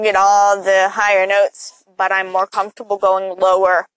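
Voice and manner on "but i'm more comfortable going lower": ordinary talk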